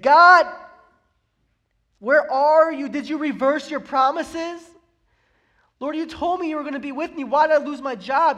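loud, high cry out to God; anguished prayer. Acted. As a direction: plead and demand answers from God.

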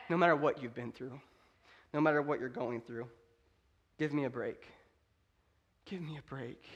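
low, hushed, emotional preaching. Real, not acted. Preacher inviting the broken to the Lord's table, quietly emotional. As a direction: drop to a soft, halting plea with long pauses. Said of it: "I'm sorry, I'm emotional about it".

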